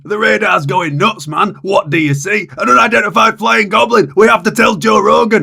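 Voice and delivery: loud frantic shouts over alarms; panicked shouting. Acted. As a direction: yell in military panic. Panicked military radar scene.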